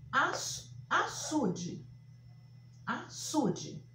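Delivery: "açude" high and slowly split, falling at the end; word dictation. Shown as a demonstration. Teacher repeating the listed words, "açude" high and split. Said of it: "eu vou só repetir aqui algumas palavras".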